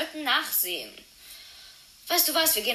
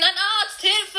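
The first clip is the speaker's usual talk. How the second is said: much higher, louder cry for a doctor; calling for help; 8 semitones higher, 5 dB louder than usual. Acted. Friend calls out for a doctor after finding Penny, alarmed.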